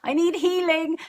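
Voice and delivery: high, sing-out voice of a pattern flagging itself; pattern calling out. Acted. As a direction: pipe up high, as if calling for attention. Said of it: "because they're just indicators".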